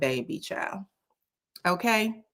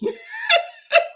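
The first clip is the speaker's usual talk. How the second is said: real laugh. Real, not acted. Recalling people who came for her, high laughter. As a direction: break into a high cackle.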